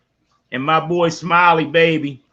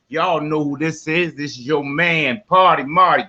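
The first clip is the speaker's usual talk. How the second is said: normal talking voice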